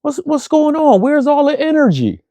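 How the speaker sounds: very high, squeaky kid impression; young girl. Kid asking her host about his energy, very high child voice. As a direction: pitch way up as a questioning child. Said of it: "one of the young kids in the program".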